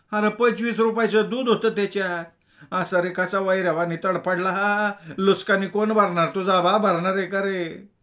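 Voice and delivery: high rustic scolding; scolding milkmaid. A narrator's reading. The milkmaid berates the narrator over her spilled milk. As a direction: demand he pay for the lost milk.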